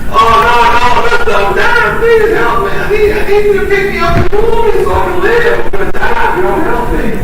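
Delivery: high, desperate snake begging for its life; pitiful pleading snake. Acted. Freezing snake begs addict man to pick it up, high pitiful pleading. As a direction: plead urgently in a high-pitched voice. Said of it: "it's a pitiful, sad little snake".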